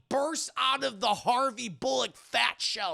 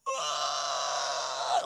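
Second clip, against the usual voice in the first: low creature screech; 7 semitones lower than usual. Retold or imitated. Robots screeching at Bruce.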